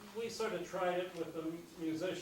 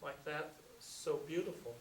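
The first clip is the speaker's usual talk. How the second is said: louder, rhythmic recital over the band; jazz poetry reading; 2 semitones higher, 6 dB louder than usual. Acted. Reading Silence with the musicians behind him, louder and rhythmic. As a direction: ride the music and let the words swing.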